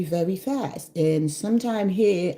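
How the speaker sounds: ordinary delivery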